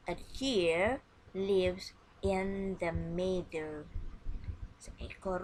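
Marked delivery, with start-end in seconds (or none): 0.1-3.8 slow model sentence. Shown as a demonstration. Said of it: "Listen and repeat after me".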